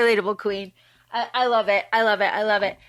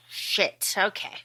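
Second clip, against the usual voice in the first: resigned; 4 semitones lower, 6 dB quieter, slower than usual. Retold or imitated. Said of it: "Jumba and Pleakley are like".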